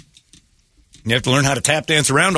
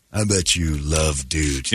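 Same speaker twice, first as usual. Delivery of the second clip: low, slow jeering taunt at a guy; a mock taunt, slowed down and dropped low to needle him; mock bar taunt; 8 semitones lower, 3 dB quieter, slower than usual. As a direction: goad him like you're picking a fight.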